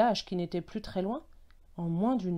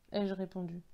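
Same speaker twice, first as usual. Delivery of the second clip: ordinary delivery, nothing marked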